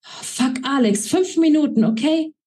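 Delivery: high, sharp irritated snap; snapped. A narrator's reading. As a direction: bite back at the interruption.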